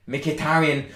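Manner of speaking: ordinary delivery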